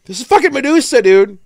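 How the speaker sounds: high, loud excited outburst; excited exclaiming. Retold or imitated. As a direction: blurt it out, amazed and loud. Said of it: "and I'm like".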